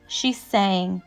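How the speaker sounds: plain reading voice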